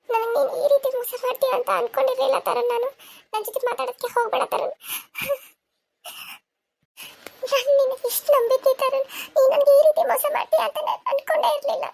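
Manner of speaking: high, loud tearful sobbing reproach; crying. Acted. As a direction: weep out the betrayal, voice breaking. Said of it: "ಅಳ್ಬೇಡ".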